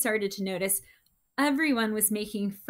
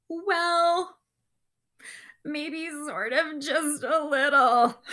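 high, slow, sheepish little voice; guilty admission; 6 semitones higher, 4 dB louder, slower than usual. Acted. Dinosaur girl confessing to her dad, sheepish. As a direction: confess it bit by bit, squirming.

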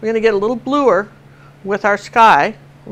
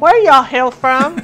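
higher, slow, mock-Southern drawl; playful Southern drawl; 4 semitones higher, 4 dB louder, slower than usual. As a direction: stretch it out in a playful Southern twang. Playful drawn-out folksy Southern saying.

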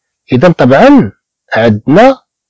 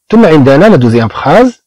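ordinary delivery

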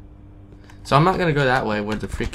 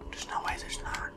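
hushed nervous whisper; creeped-out whisper; 13 dB quieter than usual. Real, not acted. Gamer in a creepy apartment whispering nervously. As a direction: breathe it so nothing hears.

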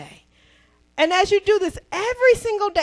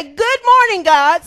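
Good morning God called out loud; proclaimed greeting; 8 dB louder than usual. Shown as a demonstration. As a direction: announce it to the whole church. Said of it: "We're gonna say".

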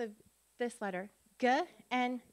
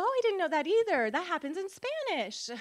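higher, louder mock delight; imitating surprised speaker; 6 semitones higher, 5 dB louder than usual. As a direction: act out a Spanish speaker's happy surprise. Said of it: "If they're a Spanish speaker, they go".

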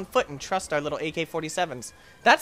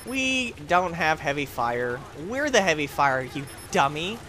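usual speaking voice

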